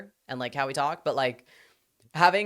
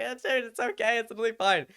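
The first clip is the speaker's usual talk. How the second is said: mock reassurance; 9 semitones higher than usual. Imitates reassuring the apologizing assistant.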